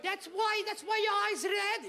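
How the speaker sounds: high, slow suspicious mom voice; high-pitched mock mom, doubtful; immigrant mother. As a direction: question him as an accented immigrant mother. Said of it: "come home and tell my mom".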